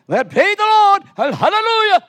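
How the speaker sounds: loud, high startled hallelujah; flustered praise. Retold or imitated. Preacher thrown off by the woman on stage, loud high praise. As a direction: cover the surprise with a big praise. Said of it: "And I'm".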